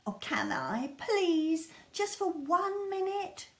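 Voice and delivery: put-on high, pleading little boy; begging child. Lester begging his mum for one minute, high and pleading. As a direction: wheedle like a child wanting a yes.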